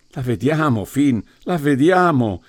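urgent crowd reply, rising in excitement; mock panting excitement. A narrator's reading. The others answer Finn on seeing the coffin, excited gasping. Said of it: "ansarono gli altri".

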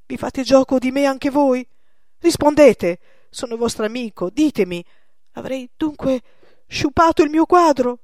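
put-on old painter begging his friend, loud and anguished; desperate plea. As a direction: beg your friend for the truth.

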